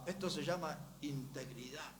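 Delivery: usual speaking voice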